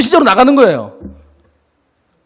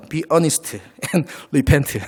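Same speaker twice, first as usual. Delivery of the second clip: very slow English read with a German-style accent; 4 semitones lower, 5 dB quieter, much slower than usual. Shown as a demonstration. Preacher reading the English verse to the church in a German-style accent. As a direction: spell out Be earnest and repent slowly in a German-style accent. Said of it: "발음이 뭐 독일식입니다".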